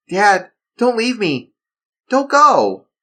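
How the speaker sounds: put-on high, frightened kid begging; cling to him and beg; pleading child. Son begging his dad not to leave, pleading.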